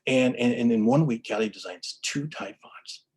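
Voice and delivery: ordinary talk, nothing marked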